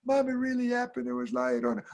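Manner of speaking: put-on high, small kid voice; pleading child. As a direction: insist plaintively that it's true. Child insists to disbelieving mom.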